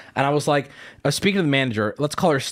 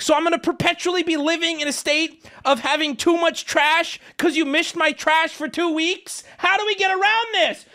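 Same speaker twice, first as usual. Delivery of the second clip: very high, strained exasperation; outraged protest; 10 semitones higher than usual. Retold or imitated. Customer protesting two weeks of missed trash, outraged. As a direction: build to a high-pitched, incredulous demand. Acted quarrel with trash company.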